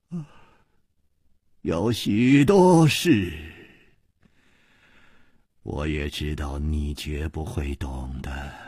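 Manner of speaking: resigned, very slow delivery; sighing resignation. A narrator's reading. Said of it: "竟又叹息了一声".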